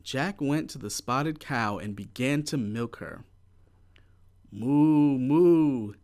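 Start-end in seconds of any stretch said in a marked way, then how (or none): 4.5-5.9 loud mooing cow. A narrator's reading. The spotted cow being milked, loud mooing. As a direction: moo like a cow.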